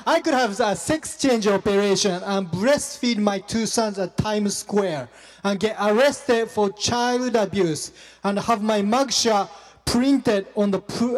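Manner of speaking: normal talking voice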